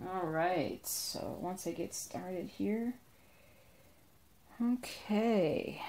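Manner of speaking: ordinary delivery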